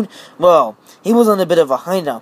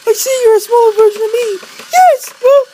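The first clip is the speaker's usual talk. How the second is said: very high put-on toy voice; Mister Lady voice; 6 dB louder than usual. Mister Lady greets the viewers, very high. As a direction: squeak it like a tiny toy.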